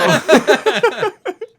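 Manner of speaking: loud, high burst of laughter. Real, not acted. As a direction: crack up for real. Laughing at own story.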